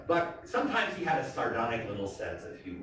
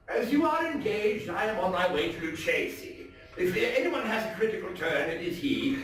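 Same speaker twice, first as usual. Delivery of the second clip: dramatic, theatrical storytelling voice; story narration; 7 semitones higher than usual. A narrator's reading. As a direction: read it like a gothic tale told by the fireside. Dramatic reading of Poe story.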